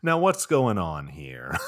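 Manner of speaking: a lightly voiced TV therapist taking charge; Doctor Phil. Host confronting the family, a bad Doctor Phil impression. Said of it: "That was a bad impression of Doctor Phil".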